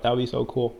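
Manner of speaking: usual speaking voice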